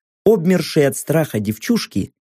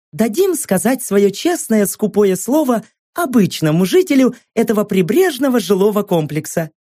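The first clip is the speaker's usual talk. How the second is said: high, upbeat broadcast read; inspiring newsreel announcer; TV presenter voice; 7 semitones higher than usual. Acted. Host narrating the report.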